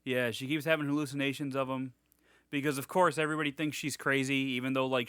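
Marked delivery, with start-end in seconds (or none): none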